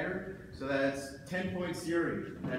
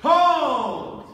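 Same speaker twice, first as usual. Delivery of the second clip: loud, sharp call to stop; shouted command; 9 semitones higher, 14 dB louder than usual. Real, not acted. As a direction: bark the halt across the floor. Referee halting fencers.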